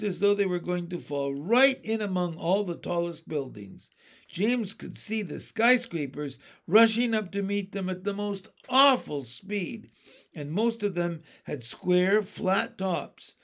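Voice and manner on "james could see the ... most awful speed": ordinary delivery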